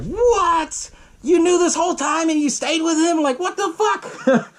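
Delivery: very high-pitched incredulous outburst; mock outraged disbelief. Viewer reacts to wife knowing the whole time, incredulous. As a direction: exclaim in disbelief, stretching the last words into a laugh.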